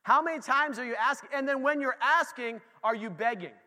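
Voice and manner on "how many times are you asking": ordinary delivery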